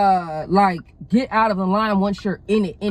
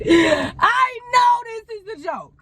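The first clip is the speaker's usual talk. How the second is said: cracking up while saying it, drawn out; can barely get the words out for laughing; 11 semitones higher, 4 dB louder, slower than usual. Real, not acted. Telling viewers about the car that almost hit her, laughing hard.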